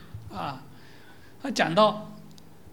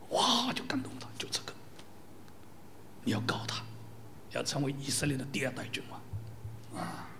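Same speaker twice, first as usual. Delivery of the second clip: soft, breathless whisper of the spirit; hushed whisper; 6 semitones lower, 12 dB quieter than usual. Acted. Preacher whispering God's inner prompting to Samuel, hushed. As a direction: whisper it like a divine secret.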